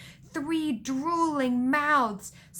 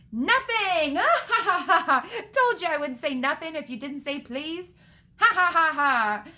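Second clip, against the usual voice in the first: high, loud cackling taunt; gloating; 6 semitones higher, 6 dB louder than usual. A narrator's reading. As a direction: crow it loudly and burst into mocking laughter. Peeves gloats and cackles at caretaker.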